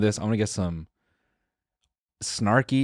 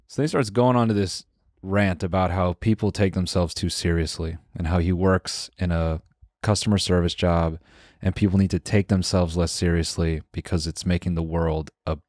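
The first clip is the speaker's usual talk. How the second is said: normal talking voice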